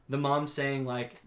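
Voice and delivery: ordinary talk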